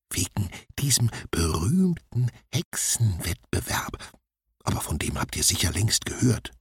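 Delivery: ordinary delivery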